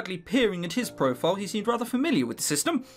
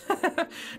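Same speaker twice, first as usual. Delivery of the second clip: nervous laugh; 12 semitones higher, 3 dB quieter than usual. Real, not acted.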